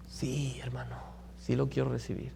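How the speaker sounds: soft meek yes. Retold or imitated.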